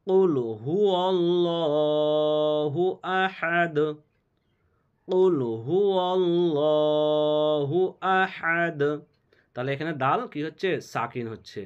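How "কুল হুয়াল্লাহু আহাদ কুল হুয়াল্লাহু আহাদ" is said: slow chant ending Ahad with the দ stopped; Quran recitation. Shown as a demonstration. Teacher showing students how Ahad stops. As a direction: recite with the final দ silent, sakin. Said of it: "দাল দু পেশে দুন".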